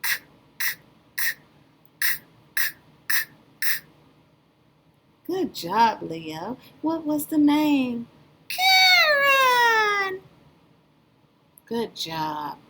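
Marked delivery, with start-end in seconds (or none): 0.0-3.8 whispered k k k over and over; crisp unvoiced k drill; k sound tapped out; repeated k sound. Shown as a demonstration. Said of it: "What did Leo leave off". 8.5-10.2 high, slow, squeaky puppet greeting; a cheerful little puppet says hello; high cartoon puppet voice calling out; puppet Leo voice. Puppet corrected.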